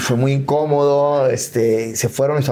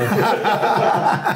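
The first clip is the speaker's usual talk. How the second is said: high laugh; 7 semitones higher than usual. Real, not acted. Laughs at own joke.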